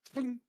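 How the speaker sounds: high, short imitated twang; ping it out like a loosed bowstring; arrow twang. Game master voices the arrow's release, high twang.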